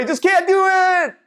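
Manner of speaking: high mock toddler whine. Speaker voicing a toddler who can't cope, high whine. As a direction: squeak it like a little kid.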